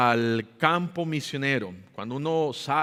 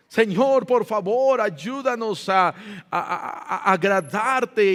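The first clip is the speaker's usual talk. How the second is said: loud, high imploring appeal; heartfelt pleading prayer; 6 semitones higher, 4 dB louder, slower than usual. Acted. Preacher voices Esdras praying to please God, heartfelt plea. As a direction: cry out earnestly to God. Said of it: "oraba Esdras de todo corazón".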